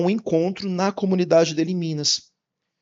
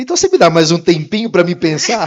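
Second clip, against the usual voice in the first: laughing through the words, louder; half-laughing request for more time; laughing reply; 2 semitones higher, 8 dB louder than usual. Retold or imitated. To his boss after the salary offer, laughing.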